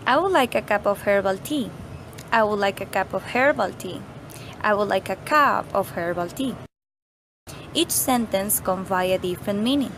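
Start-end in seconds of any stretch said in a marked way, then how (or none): 2.3-4.0 "herbal" singled out with a falling accent; stress on HERBAL. Shown as a demonstration. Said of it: "we are emphasizing that we want herbal tea". 4.6-6.6 "cup" accented, the line ending on a rise; weight on "cup", rising tune at the end; stress on CUP. Shown as a demonstration. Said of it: "we're saying that we want a cup, not a mug".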